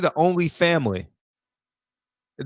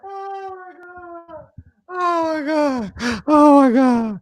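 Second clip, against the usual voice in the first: high, drawn-out wail through laughter; helpless laughter; 11 semitones higher, much slower than usual. Real, not acted. Streamer cracking up over a silly dish name, high laughing wail. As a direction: stretch it out, high and weak with laughing.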